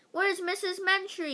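high-pitched, mild yell. A narrator's reading. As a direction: call the question out high. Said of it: "yelled Mister Granite".

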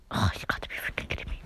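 fast, breathy whisper; annoyed whisper to himself; whispered frustration. Real, not acted. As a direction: hiss it under your breath.

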